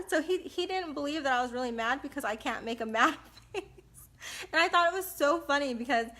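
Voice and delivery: ordinary talk, nothing marked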